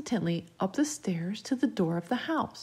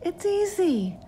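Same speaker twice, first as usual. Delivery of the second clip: put-on high, coaxing child; ghostly playmate luring him; eerie child's voice; 11 semitones higher, slower than usual. A narrator's reading. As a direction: reassure up high like a little girl. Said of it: "the voice responded".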